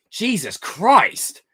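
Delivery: loud, slow, drawn-out shocked cry; shocked exclamation. Real, not acted. Streamer reacts to a chat question with a shocked cry. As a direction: blurt it out, stretched and loud.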